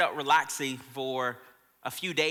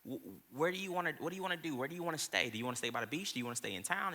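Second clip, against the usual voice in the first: soft asking wife; 3 semitones lower, 10 dB quieter, faster than usual. Retold or imitated. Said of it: "And I said".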